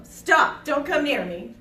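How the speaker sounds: loud commanding shout; firm yell. Shown as a demonstration. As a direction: yell it to stop an attacker. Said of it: "Yell at 'em, tell 'em".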